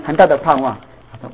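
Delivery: usual speaking voice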